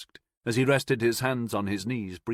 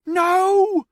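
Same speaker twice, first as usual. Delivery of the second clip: high, loud shrieked no; 9 dB louder than usual. A narrator's reading. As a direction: shriek to stop them firing. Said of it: "the voice shrieked, higher-pitched".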